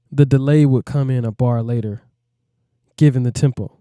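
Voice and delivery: usual speaking voice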